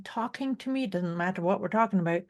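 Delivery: ordinary talk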